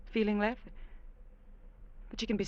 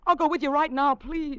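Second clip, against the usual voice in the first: desperate plea; 6 semitones higher, 5 dB louder than usual. Acted. Begging him to leave tonight.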